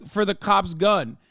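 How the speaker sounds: usual speaking voice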